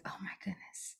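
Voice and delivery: breathy whispered dismay under the breath; whispered aside. Retold or imitated. Recalling her mom's old line, whispering. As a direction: mutter it to yourself, almost no voice. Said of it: "I was like".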